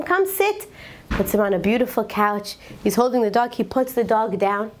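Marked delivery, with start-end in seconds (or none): 0.0-0.6 high, coaxing invitation; inviting. Retold or imitated. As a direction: imitate a host coaxing a guest to sit. Host seats scared visitor.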